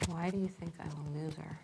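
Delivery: softly, as if asking yourself; quiet, inward. Real, not acted. Client asking her little voice about being a loser, quietly. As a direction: repeat it privately at reduced volume. Repeats coach's question to inner voice.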